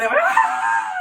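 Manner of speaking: very high, loud "ah" scream; all-out scream. Retold or imitated. Family screaming together in the car, full-force re-enacted scream. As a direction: let rip with a long shriek. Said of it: "we all screamed as loud as we could".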